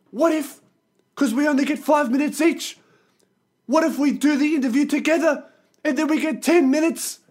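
very high mock Kiwi bro voice; hammed-up drunk Kiwi pitching a brilliant plan; wasted Kiwi guy. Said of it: "And he's off his face".

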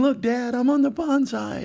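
put-on high childish call to Dad; kid calling to dad. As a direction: show off to Dad like a small kid. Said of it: "And Dino saying".

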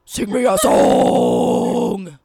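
very low, slow gravelly monster growl; Cookie Monster voice. Acted. Friend mocking the song as Cookie Monster, deep monster growl. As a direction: drag it out deep like a Muppet monster. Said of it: "I just sound like Cookie Monster".

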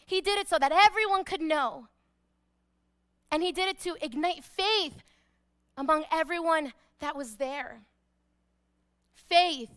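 usual speaking voice

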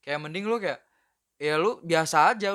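normal talking voice

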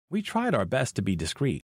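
ordinary delivery

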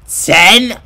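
high, loud disbelief; can't believe it; surprised. Real, not acted. Shocked at small amount.

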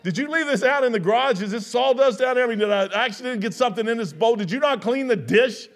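husband hamming up disbelief at his wife's breakfast; mock outrage. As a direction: fire off questions like something's gone wrong. Retold complaint to wife about oatmeal.

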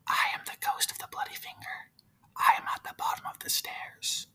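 soft eerie ghost whisper; spooky unvoiced ghost whisper; ghostly whisper. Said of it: "he heard a bit louder".

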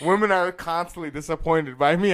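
loud and laughing. Real, not acted. Laughing self-mockery.

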